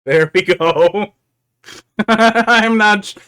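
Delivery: loud laughing, drawn out. Real, not acted. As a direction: laugh through the words. Laughing at own drawing.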